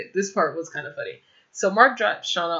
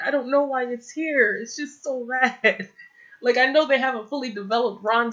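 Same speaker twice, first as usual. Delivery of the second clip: exasperated; 6 semitones higher than usual. Real, not acted. Exasperated rant about the show.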